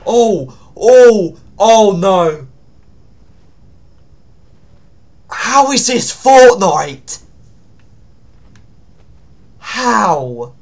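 loud, high cries of disbelief; big, drawn-out astonished shouts; shocked outcry. Real, not acted. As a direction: exclaim in stunned horror. Shocked by the horror map.

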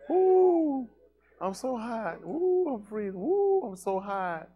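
high, drawn-out complaining voice; mock the whiny complainers. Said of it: "they'll be hollering one day".

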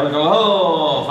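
long, drawn-out victory whoop, moderately loud; triumphant cry. Acted. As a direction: call out a celebratory slogan. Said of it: "وہ ایک نعرہ لگائے". (خوشی کا نعرہ)

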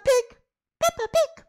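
very high, loud cartoon piggy; Peppa Pig voice. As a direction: squeal the name like a cartoon pig.

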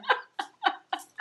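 a sudden high-pitched giggle. Real, not acted. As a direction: burst into a high laugh. Laughing at sour lemon.

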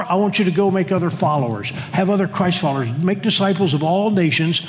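ordinary delivery

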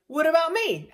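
high, loud indignant cry; pleading horse voice. Pretend. Owner voicing her horse wanting the trailer too, loud high plea. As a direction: voice the horse feeling left out. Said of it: "and Indy's like".

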